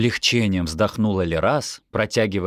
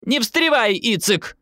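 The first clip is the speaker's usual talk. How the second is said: very high, sharp retort; irritated high-pitched rebuff; snapping back; 14 semitones higher, slower than usual. A narrator's reading. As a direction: snap at your husband to stay out of it. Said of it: "огрызнулась мама".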